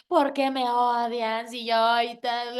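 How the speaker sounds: slow, whiny hypothetical lament; a light, slowed sulk; mock self-pity. She mimics dwelling on haters, slow and whiny.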